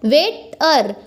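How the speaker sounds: Waiter said as "wait - er"; waiter with a short gap before the last syllable "er"; split into syllables. Shown as a demonstration. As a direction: break waiter into two distinct beats. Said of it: "इसमें दो syllable आया है".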